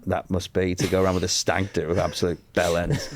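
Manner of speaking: ordinary talk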